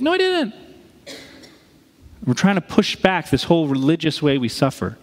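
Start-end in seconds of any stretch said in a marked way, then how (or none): 0.0-0.5 high, whiny insistence; protesting Job. Retold or imitated. Job insisting to his friends he did nothing, high and whiny. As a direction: plead your innocence up high. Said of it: "And he's like".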